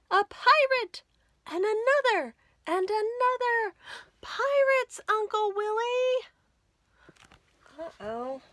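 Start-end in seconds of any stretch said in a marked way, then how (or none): 0.1-6.3 high, loud panicked shouts; alarmed cry. Acted. Character shouting in panic as pirates board the boat.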